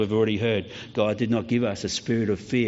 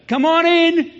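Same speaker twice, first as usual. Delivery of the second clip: put-on high, loud voice of an old lady calling out; old customer calling the milk boy in; 8 dB louder, slower than usual. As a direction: call him in from inside the house. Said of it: "And she'd say".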